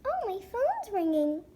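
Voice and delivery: ordinary talk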